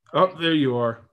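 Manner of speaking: ordinary talk, nothing marked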